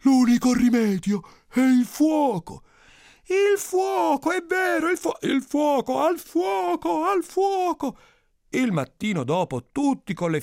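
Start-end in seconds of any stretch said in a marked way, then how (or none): 0.0-2.6 slow, high, loud proclamation; shouting. Acted. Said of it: "uno si alza e urla". 3.2-8.0 high, drawn-out rallying cries; crowd shouting. Acted. Crowd takes up the cry.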